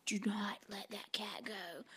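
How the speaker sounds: urgent hushed whisper. Retold or imitated. Boyfriend whispering behind her so the kitten doesn't run. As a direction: breathe it so the cat won't bolt.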